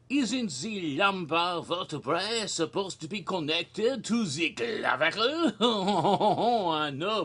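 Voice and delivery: hammed-up French accent with chuckles; fake French accent. Doctor showing a nurse an X-ray, fake French accent. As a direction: play a comic Frenchman, French accent throughout. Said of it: "this is the wrong fake accent".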